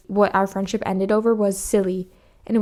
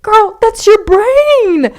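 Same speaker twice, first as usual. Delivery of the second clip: higher, louder, slow sassy drawl; sassy comeback; 13 semitones higher, 10 dB louder, much slower than usual. Retold or imitated. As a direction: draw it out high and loud like a sassy diva. Retold sassy comeback to friend.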